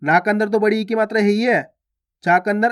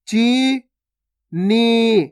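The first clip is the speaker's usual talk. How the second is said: चीनी split as chee, pause, nee; syllable by syllable; much slower than usual. Shown as a demonstration. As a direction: slowly sound out चीनी in two held syllables. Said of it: "direct मात्रा का उच्चारण करके पढ़ेंगे". (चीनी को तोड़कर, ची... नी)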